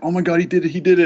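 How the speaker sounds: high, elated retold cheer; excited cheering. Retelling a weird flux of emotions, excited cheering. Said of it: "such a weird flux of emotions".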